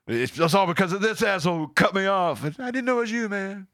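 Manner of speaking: high apologetic excuse; lightly voiced embarrassed driver; sheepish road rager. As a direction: back down from a fight, sheepishly.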